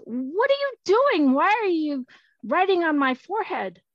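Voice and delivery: high, loud outraged questions; affronted child's protest; indignant boy. Acted. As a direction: object loudly with leaping pitch. Boy objects to being written on.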